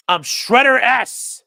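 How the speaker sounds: loud, drawn-out wrestling announcer call; WWE announcer. Pretend. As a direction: boom it out slowly like a ring announcer. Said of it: "your W W E announcer voice".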